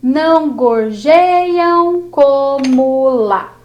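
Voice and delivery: teacher scanning the last verse slowly; slow scansion. Shown as a demonstration. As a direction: stretch "Não gorjeiam como lá" into counted beats. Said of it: "Vamos para o próximo verso".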